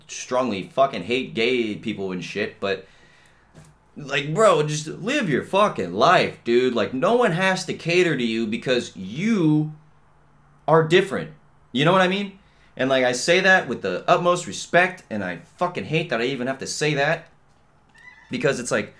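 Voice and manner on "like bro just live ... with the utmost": loud, worked-up rant; heated. Real, not acted. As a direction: raise your voice in frustration.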